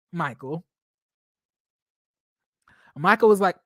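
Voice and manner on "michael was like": ordinary talk, nothing marked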